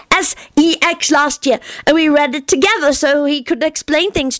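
put-on high, earnest childish voice; a little boy explaining things very seriously; young Teddy. A narrator's reading. Narrator voicing Teddy explaining to grown-ups, high childish earnest.

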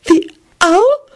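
high, startled squeak; small, very high question. A narrator's reading. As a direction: squeak it in disbelief. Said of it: "Beatrice squeaked".

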